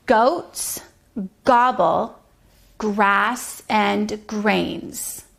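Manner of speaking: very slow, word by word; deliberate teaching read. Shown as a demonstration. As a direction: spell it out slowly for a child. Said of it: "reading message slowly".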